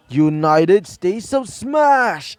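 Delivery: slow, drawn-out superhero attack call; heroic battle cry. Acted. Hero's battle cry while striking.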